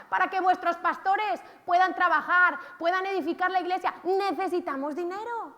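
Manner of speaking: rally the church about money; fervent preaching. Real, not acted. Preacher urging congregation, loud and fervent.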